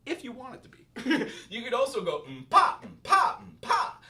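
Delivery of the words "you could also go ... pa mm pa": laughing, higher, louder mm-pa rising to a breath; accented mm-pa. Shown as a demonstration. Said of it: "put the inflection on any note".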